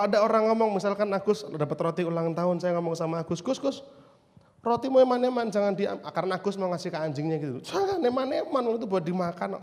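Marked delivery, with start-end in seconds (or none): 3.4-6.0 higher-pitched urgent appeal to a friend; mock nagging plea; acted admonishing. Acted scolding of Agus over bread. 7.6-9.6 high-pitched mock plea, easing down; playful nagging protest; acted admonishing. Acted plea to Agus again.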